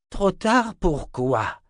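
put-on old wizard grumbling at his grandson; grumbling old man. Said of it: "grogna Zed". (vieux sorcier qui grogne, lentement)

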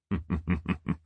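a demon's hushed, pleased laugh; soft gloating chuckle. Acted. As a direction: chuckle to yourself over your prize. Balsamon gloating over Kari.

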